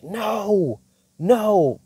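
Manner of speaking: high, stretched-out mock wail; devil losing his mind. Devil told to get out, high frantic protest. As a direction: imitate a crazed devil way up high. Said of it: "He loses his mind".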